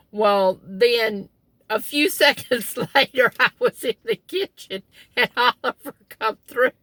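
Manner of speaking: ordinary talk, nothing marked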